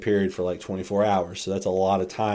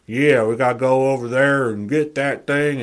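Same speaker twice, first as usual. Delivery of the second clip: higher, louder fake tough-guy voice; showy poser voice, pitched up and pushed; poser self; 5 semitones higher, 6 dB louder than usual. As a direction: put on a cool poser act. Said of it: "it would be the poser self talking".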